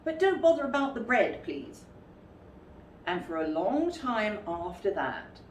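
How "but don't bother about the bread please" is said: higher, fast, polite add-on; polite Pooh. Acted. Pooh correcting himself so as not to seem greedy, quick and polite. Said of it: "so as not to seem greedy".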